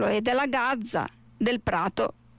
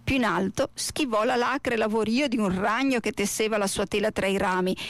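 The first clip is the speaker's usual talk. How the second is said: ordinary delivery, nothing marked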